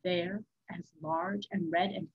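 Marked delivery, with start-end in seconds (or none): none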